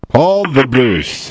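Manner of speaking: put-on slow, rolled Scottish accent on the name; mock Scottish burr for a caller's name. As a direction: announce him like a proud Scotsman. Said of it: "in a Scottish accent".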